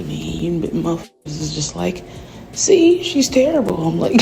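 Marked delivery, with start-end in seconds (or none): 2.5-3.8 much higher, louder mocking jab; mock gloating critic. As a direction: imitate a critic in a high voice. Imitates critics saying she's terrible.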